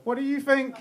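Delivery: high-pitched, as if it's a silly question; dismissive comeback. Shown as a demonstration. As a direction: toss the question back as obviously stupid. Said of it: "Stupid question".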